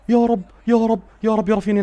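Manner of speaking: loud, high prayer; urgent pleading. Real, not acted. Fan praying Raphinha delivers, high urgent pleading. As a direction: beg loudly for the goal.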